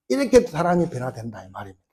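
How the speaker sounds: usual speaking voice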